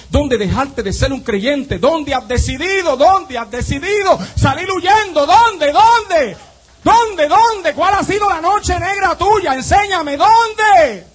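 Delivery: loud, high, building to a shout; full-force preaching. Real, not acted. Preacher challenges congregation on where they gave up, full-force preaching.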